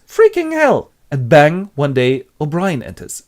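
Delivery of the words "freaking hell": much higher, louder swear; shocked Winston. Acted. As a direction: blurt it out. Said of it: "And Winston goes".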